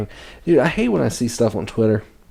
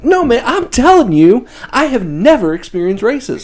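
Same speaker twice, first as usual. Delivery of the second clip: high, loud put-on [name] voice; [name] impression; 13 semitones higher, 5 dB louder than usual. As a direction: ham up [name] insisting he's never faced racism. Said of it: "he's like".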